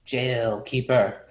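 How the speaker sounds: two words drawn out flatly; slow, deliberate master's pronouncement; slow terse verdict. Acted. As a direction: say it slowly like a closing sentence. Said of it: "Master Fang goes like this".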